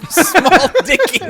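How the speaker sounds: high, loud squeal through laughter; laughing squeal. Real, not acted. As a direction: shriek the name while cracking up. Repeating a funny show name.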